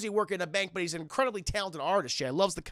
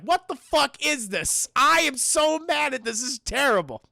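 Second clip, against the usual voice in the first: acted outrage; 4 semitones higher, 4 dB louder than usual. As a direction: play an indignant rant, stretching the words. Retold outrage of resentful Barney.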